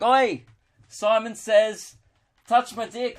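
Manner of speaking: high, slow comic holler; crude mock shout-out. Comic acts out a terrible chat-up line, high and drawn out. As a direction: call it out high, stretching the words.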